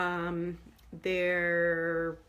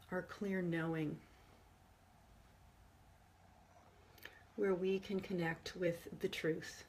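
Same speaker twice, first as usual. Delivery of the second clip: soft meditation voice with long pauses; guided meditation; 8 dB quieter than usual. Real, not acted. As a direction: guide listeners gently inward, hushed and unhurried. Leads a forgiveness meditation.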